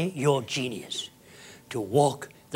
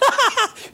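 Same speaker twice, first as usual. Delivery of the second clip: high-pitched jeering cackle; squealing derisive laugh; mocking high laugh; 6 dB louder than usual. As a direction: cackle like the scoffing crowd.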